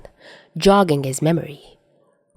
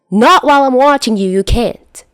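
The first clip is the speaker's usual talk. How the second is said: high, loud stern yell; angry shout; 7 semitones higher, 6 dB louder than usual. Acted. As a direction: lay down the law at full volume. Shouting match continues.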